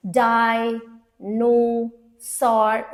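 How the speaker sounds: डायनोसॉर stretched very slowly into its three syllables; syllable counting. Shown as a demonstration. Teacher counting syllables aloud in a word. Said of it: "three syllable word".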